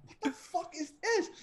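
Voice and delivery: high-pitched retold bewilderment; stunned disbelief. Retold stunned reaction to the scene.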